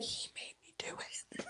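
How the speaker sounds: soft mock whisper, pinning it on him; whispered blame. Whispering to the cop that her friend made her do it. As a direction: whisper the excuse like a tattletale.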